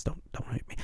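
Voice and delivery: breathy half-whisper, mock pleading; whispered plea. Real, not acted. Begging [name] fans not to hate him, whispered. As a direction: whisper it like a guilty aside.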